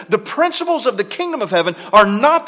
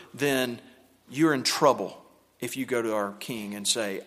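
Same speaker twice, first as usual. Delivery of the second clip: usual speaking voice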